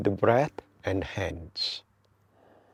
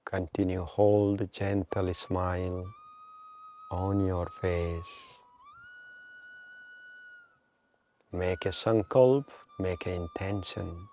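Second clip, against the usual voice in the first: slow, measured meditation guide with long pauses; guided meditation; 5 dB quieter, slower than usual. Real, not acted. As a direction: draw out inhale and exhale cues to pace the breath. Leads a breathing meditation.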